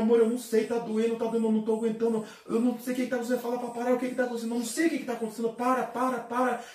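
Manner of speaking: high, loud screams of pain, begging it to stop; desperate screaming. Retold or imitated. Man in agony begging the pain to stop, desperate loud screams. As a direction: shout as if the pain is unbearable. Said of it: "o meu desespero e o meu grito".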